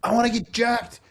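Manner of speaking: high whiny son voice; whiny kid voice. Retold or imitated. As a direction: beg your dad like a whiny kid. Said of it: "I'm like".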